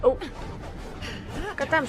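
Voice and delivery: ordinary talk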